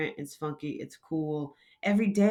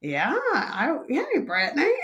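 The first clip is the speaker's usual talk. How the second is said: put-on old lady voice; 7 semitones higher than usual. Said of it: "she's like".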